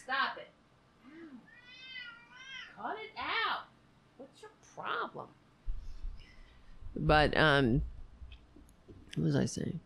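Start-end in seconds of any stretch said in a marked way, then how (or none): 0.0-5.3 high, soft scolding; shrill nagging mom. Real, not acted. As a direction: tell off the pet, pitched high. Scolding the cat again.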